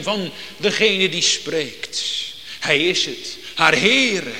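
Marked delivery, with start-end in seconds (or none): 2.6-4.3 blij herkennen. Acted. Maria recognises the Lord's voice.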